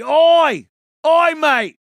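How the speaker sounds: high, loud "Oi, mate!" yelled like a cop in the crowd; mock Aussie shout. Imitating Aussie cops shouting.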